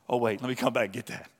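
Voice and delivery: normal talking voice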